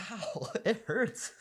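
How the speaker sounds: slow pained laugh. Real, not acted. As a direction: groan it through laughter. Nose burning.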